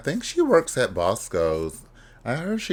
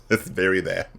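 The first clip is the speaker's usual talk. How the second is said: usual speaking voice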